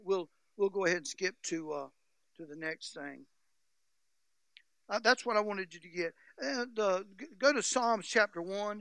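ordinary delivery

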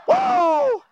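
high shouted whoa; ecstatic whoa. Real, not acted. Preacher hollers with joy while preaching. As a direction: let out a joyful holler.

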